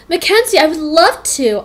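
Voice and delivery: high, eager gush. A narrator's reading. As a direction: gush like you've waited all year for this. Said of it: "I gushed".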